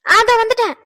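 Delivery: high, loud answering call; calling back. Acted. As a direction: shout back that you're on the way. Wife calls back she is coming.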